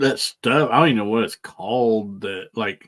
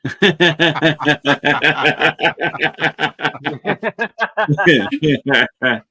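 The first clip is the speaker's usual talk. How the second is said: long burst of high laughter; cracking up with the group; loud laughter; 6 semitones higher, 3 dB louder than usual. Real, not acted.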